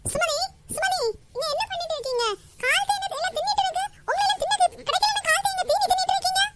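shrill scolding aunt. Acted. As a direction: call out and scold her like a fussy older woman. Scolding Sumathi in a new voice. (உயர்ந்த குரலில் திட்டும் அக்கா)

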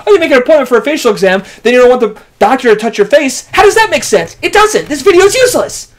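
high loud ranting. Acted. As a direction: rant at the camera. Ranting after quarrel.

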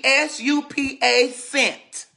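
each letter spelled out slowly; slowly spelling out S U P A before Scent. Shown as a demonstration. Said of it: "That's her name".

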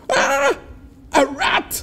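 very high, loud startled shriek; frightened. A narrator's reading. Frightened Anansi mistakes the squirrel for a rat, shrieking. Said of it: "Anansi got frightened and said".

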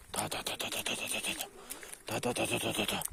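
very soft, rapid tongue-tapped syllables; whispered ta-ta calling. Real, not acted. Owner urging his dog along, quiet ta-ta signal. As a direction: tick off quiet little ta-ta-ta bursts.

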